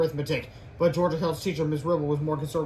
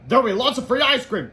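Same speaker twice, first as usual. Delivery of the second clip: loud, high teacher voice; raised, pitched-up announcing voice; teacher's announcement; 7 semitones higher, 7 dB louder than usual. A narrator's reading. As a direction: dangle a treat before the class. Teacher announces treat to class.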